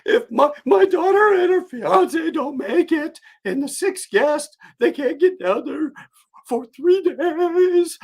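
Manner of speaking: high, slow, tearful pleading; crying woman. Retold or imitated. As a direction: plead through tears, drawn out. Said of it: "the woman is crying".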